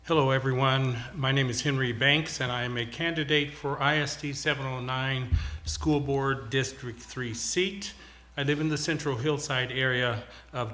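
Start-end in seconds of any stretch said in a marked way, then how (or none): none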